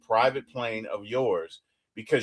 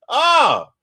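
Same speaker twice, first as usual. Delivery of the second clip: very high, loud grossed-out oh; disgusted oh; 10 dB louder than usual. Real, not acted. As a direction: groan at the thought of the filthy pond. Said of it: "Oh, it was just disgusting".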